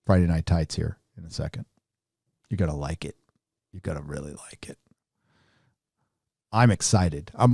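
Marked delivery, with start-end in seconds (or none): 0.1-4.8 quiet teasing. Real, not acted. Teasing a surprise.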